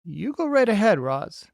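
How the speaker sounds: bright, folksy beaver. Acted.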